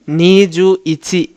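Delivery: "Niju ইচি" said slowly, syllable by syllable; slow demo. Shown as a demonstration. Teacher demonstrates twenty-one slowly for students. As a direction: lay the number out slowly for the class. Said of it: "দুই দশ নিজু এক ইচি".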